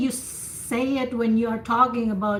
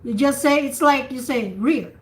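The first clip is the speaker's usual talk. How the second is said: ordinary delivery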